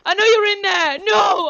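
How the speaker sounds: loud, high urgent plea; desperate insistence. Pretend. Reaching the real Slush inside, desperate insistence. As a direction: shout through to the friend trapped inside. Pleading with possessed friend.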